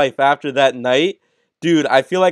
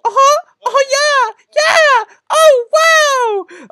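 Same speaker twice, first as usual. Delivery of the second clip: very high-pitched mock moans, building louder; imitated moaning; 5 dB louder, much slower than usual. Storyteller mimicking the gym guy on each leg raise, high moaning. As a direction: squeal and moan in exaggerated ecstasy. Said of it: "this man's was moaning".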